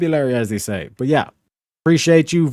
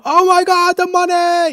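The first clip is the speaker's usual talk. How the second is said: squealing very high with joy; hammed-up money squeal; mock ecstatic. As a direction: shriek over a tub full of cash. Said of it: "and I go".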